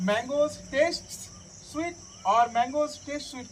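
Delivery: slow, word by word; very slow dictation. Shown as a demonstration. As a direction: spell it out slowly for the class. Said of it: "ভালো করে শোনো".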